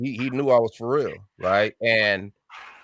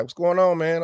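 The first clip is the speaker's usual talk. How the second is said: caller's greeting; 7 semitones higher than usual. Retold or imitated. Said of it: "And he was like".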